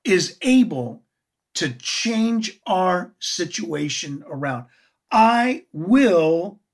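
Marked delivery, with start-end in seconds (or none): 5.1-6.6 "I will" louder, both words firmly stressed. Shown as a demonstration. Preacher stressing Jesus' promise to the weary: firm emphasis. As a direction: lean hard on "I will", as a promise. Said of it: "not might".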